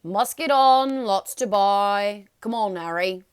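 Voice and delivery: very loud, slow booming call; calling over babble. A narrator's reading. Hagrid calls Harry over the pub's babble, very loud and slow. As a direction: boom it over a noisy crowd. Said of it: "managed to make himself heard over the babble".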